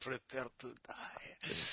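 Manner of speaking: slow, soft fake Spanish duelist voice; mock Spanish swordsman. Co-host joking about the Spanish intonation, slow, soft mock swordsman. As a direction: drawl it out like a hushed movie swordsman.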